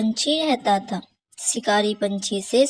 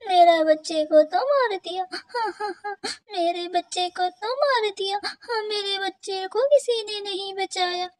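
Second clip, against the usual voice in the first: high-pitched sobbing lament over a lost chick; crying bird mother; 9 semitones higher than usual. Acted. Bird mother crying alone for her dead chick, sobbing. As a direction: wail it through tears, voice breaking high. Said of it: "ये रोने की आवाज किसकी है".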